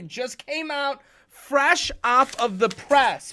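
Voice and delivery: high, loud, drawn-out hype; mock newsflash hype. Host announcing a joke discovery to kids, hyped. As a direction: sell it like breaking news, slowly and loudly.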